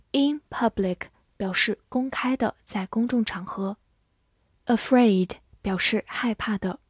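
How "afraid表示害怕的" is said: normal talking voice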